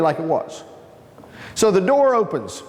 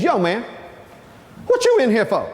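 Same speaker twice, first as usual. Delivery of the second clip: lightly voiced prison cellmate, raised at the end; imitated cellmate; 3 semitones higher than usual. Cellmate breaks his silence to the newcomer, lightly imitated. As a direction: mimic his challenge, a little higher. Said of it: "exactly the way he said it".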